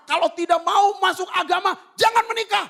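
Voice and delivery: high, loud angry shout; furious relatives shouting an ultimatum; angry family. Retold or imitated. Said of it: "benar dia marah". (teriakan marah keluarga)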